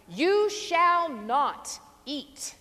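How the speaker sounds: slow, loud, scolding prohibition; threatening tone. Shown as a demonstration. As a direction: lay down the law like a finger-wagging mother. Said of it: "spoken in a threatening tone with that wagging finger".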